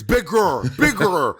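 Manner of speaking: exaggerated imitation of a shouted note; mock coaching yell. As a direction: bellow the note like an overbearing coach. Mimicking least favourite judge's note.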